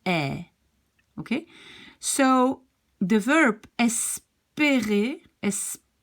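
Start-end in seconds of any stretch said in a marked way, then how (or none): 0.0-0.5 "E" said as an open eh; open French è. Shown as a demonstration. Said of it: "If it's going down". 3.8-5.2 "espérer" slow, with closed é; drawn-out closed é. Shown as a demonstration. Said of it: "it's an E".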